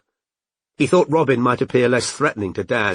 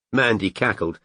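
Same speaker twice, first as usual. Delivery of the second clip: usual speaking voice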